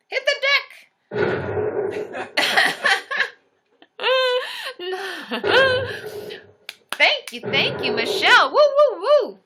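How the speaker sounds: high loud whooping; high loud celebratory whoops for the likes; excited cheering. Real, not acted.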